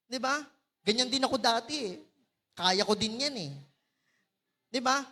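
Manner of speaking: ordinary talk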